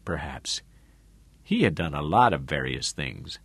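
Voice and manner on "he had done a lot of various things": ordinary delivery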